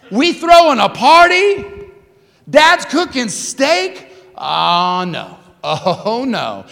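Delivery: loud, high indignant disbelief; angsty older brother. Acted. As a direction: balk loudly at the news, drawing out key words. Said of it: "get the angst of the older brother".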